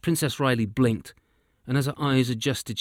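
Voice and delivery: plain reading voice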